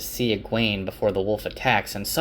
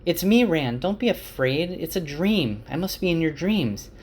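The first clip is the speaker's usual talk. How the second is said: yelling; 8 semitones higher than usual. A narrator's reading. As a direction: call out high to reach him. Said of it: "And she yells".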